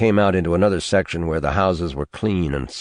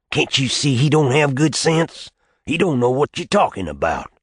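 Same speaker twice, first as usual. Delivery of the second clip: put-on old man's voice raised higher, pressing; exasperated old man; 8 semitones higher than usual. Old man urging his nephew that the child lacks sense, raised and insistent. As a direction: make him see, insistent and raised.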